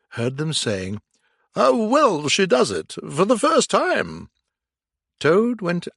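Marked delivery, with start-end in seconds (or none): 1.5-4.3 admiring. A narrator's reading. Gentlemen applaud.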